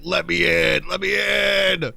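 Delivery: high, drawn-out pleading yell; begging shout. Pretend. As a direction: beg at the top of your voice. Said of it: "I'm begging".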